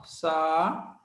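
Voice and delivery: saída broken into "Sa... E... Da", each held apart; teacher sounding out syllables one at a time. Shown as a demonstration. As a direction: separate each syllable of saída with long pauses.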